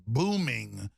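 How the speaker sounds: ordinary talk, nothing marked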